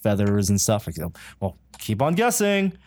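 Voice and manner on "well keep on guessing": high-pitched, coy quote of a teaser; teasing. Retold or imitated. Costumed stranger keeps photographer guessing, imitated teasingly. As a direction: egg the guesser on in a higher voice.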